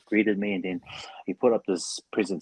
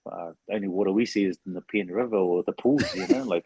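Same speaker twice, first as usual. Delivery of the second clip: ordinary delivery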